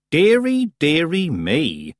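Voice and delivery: high, slow, sarcastic sigh; mock concern. A narrator's reading. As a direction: fake dismay, drawn out.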